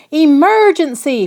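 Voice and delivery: Father crying out in alarm. Acted. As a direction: shout the alarm at the alligators. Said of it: "Father cried".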